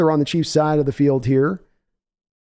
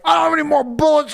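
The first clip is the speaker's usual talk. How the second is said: high, yelled, panicky mock Nolte; hammed-up Nick Nolte in a panic; Nolte impression; 11 semitones higher than usual. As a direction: shout it like a cornered Nolte. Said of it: "Nick's over there like".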